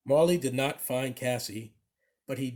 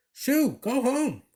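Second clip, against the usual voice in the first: slow, high shooing yell; 10 semitones higher, 3 dB louder, slower than usual. A narrator's reading. Lunch lady shooing Marley out of the kitchen, slow high yell. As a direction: drive the dog out, stretched and high. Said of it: "one of the lunch ladies yelled".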